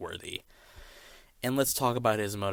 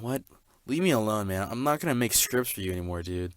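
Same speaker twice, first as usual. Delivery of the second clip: ordinary talk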